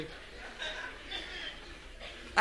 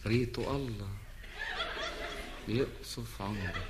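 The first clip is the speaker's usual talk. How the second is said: exasperated muttered curse; 11 semitones lower, 12 dB quieter, much slower than usual. Acted.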